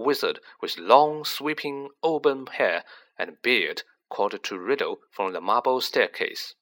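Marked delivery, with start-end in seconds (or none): none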